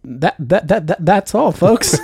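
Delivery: a bad stammering Porky Pig; hammed-up cartoon stutter sign-off; Porky Pig impression. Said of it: "That's terrible".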